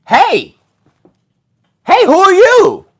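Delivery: high, bright-pitched, calling out curiously; high-pitched newcomer calling hello; another personality. Retold or imitated. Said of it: "another personality came out".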